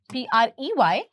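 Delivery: ordinary talk, nothing marked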